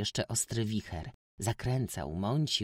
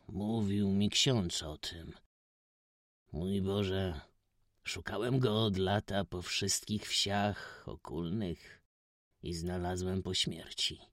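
grieving gentleman. A narrator's reading. Stranger tells he found Kuba only after death, slow sorrow. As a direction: sigh over a search too late.

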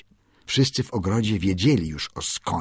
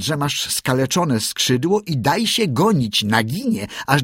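higher, brisk mongoose voice giving orders; commanding Riki; 6 semitones higher than usual. A narrator's reading. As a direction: instruct the bird firmly.

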